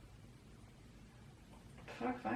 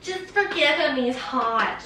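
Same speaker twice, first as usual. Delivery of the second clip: ditzy friend voice; 5 semitones higher, slower than usual. Retold or imitated. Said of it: "she's like".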